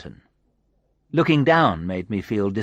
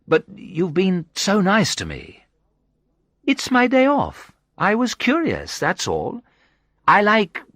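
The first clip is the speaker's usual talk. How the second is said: higher, breezy woman's voice; lightly voiced woman; 7 semitones higher than usual. A narrator's reading. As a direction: play the curious friendly woman. Sandra's voice in dialogue.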